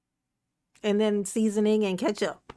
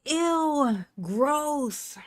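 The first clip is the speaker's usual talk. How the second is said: disgusted squeal, pitched up; grossed out; 5 semitones higher, 3 dB quieter than usual. Pretend. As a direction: say it like a picky kid. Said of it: "they gonna be like".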